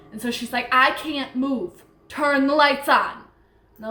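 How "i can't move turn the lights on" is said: retold frightened plea, rising louder and higher; urgent, drawn-out cry for help; terrified. Paralyzed Hannah begging for the lights, terrified. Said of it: "was terrified".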